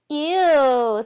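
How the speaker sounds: loud high ew; grossed-out girl; girl squealing ew in disgust. A narrator's reading. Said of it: "said one of the girls".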